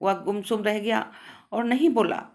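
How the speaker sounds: ordinary delivery, nothing marked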